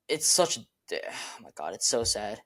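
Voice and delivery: deflated and hushed; dejected lament. Real, not acted. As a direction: sink down into real disappointment. Lamenting a wasted career.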